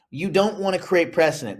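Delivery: ordinary talk, nothing marked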